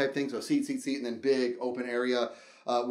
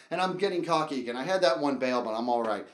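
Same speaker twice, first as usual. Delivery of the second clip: ordinary delivery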